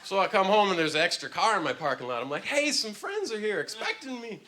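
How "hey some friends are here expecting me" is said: naive cheer. Retold or imitated. Comic quotes his naive self.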